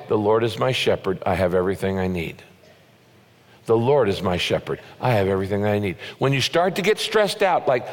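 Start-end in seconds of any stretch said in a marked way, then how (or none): 0.1-6.0 lower, gentle, unhurried affirmation; calm affirmation. Shown as a demonstration. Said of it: "you need to pause and say".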